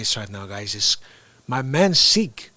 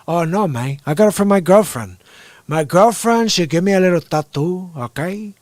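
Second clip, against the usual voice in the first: put-on Scarface Cuban accent; 3 semitones higher, 5 dB louder than usual. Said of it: "He goes".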